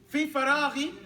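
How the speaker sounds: loud, careful model of فراغي; model pronunciation. Shown as a demonstration. Teacher models the phrase loudly so students repeat it. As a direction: say في فراغي for the class to copy. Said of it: "teacher models phrase for repetition".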